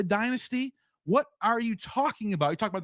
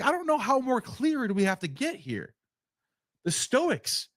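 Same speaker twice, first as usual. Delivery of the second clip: ordinary talk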